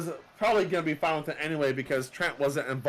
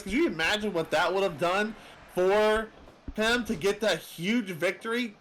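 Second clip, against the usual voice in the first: usual speaking voice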